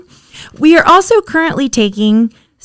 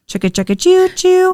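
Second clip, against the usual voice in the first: chugging toy train voice; 9 semitones higher than usual. Pretend. Podcast outro, train sound joke.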